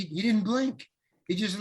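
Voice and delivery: normal talking voice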